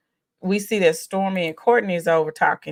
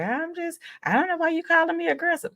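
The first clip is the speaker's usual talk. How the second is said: high, fast mock of a defensive reply; mocking Tisha; 11 semitones higher, 3 dB quieter, faster than usual. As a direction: parody her protest, pitched up and quick. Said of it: "And she was like".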